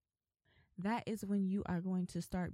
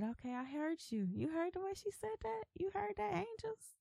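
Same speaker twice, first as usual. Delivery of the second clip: high-pitched, delighted voice climbing higher and higher; God, playfully voiced; 11 semitones higher than usual. God proudly calling the angels to hear her, high excited voice. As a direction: play an impressed God, pitch rising to a squeal. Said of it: "God said".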